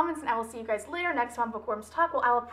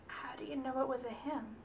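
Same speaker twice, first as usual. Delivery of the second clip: acted near-silent whispered question; stunned whisper; 13 dB quieter than usual. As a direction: whisper it, barely any voice.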